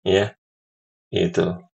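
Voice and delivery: ordinary talk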